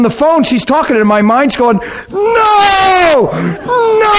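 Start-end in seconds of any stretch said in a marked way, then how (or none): 2.1-4.1 high-pitched, wailing shout of protest; frantic inner scream. Retold or imitated. Woman on the phone screaming inside at the news, frantic wail. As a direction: cry out as if going bananas. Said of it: "I'm going bananas".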